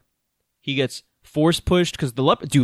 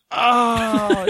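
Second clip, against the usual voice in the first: long, high drawn-out dying "oh"; mock death scream; 9 semitones higher, 3 dB louder than usual. Co-host imitating [name]'s death scene, mock death scream. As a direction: wail it out like a hammed-up movie death. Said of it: "that whole death scream".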